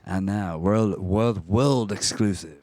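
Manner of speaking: slow, mock announcer voice for a world exclusive; bringing his idea to the mic as a mock announcer. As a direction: trumpet it like a big reveal.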